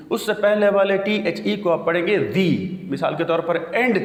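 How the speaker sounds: usual speaking voice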